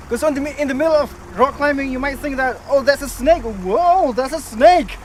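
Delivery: sci-fi trailer narrator. Pretend. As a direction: boom every line like an epic movie trailer. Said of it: "I sound like a sci-fi movie trailer narrator".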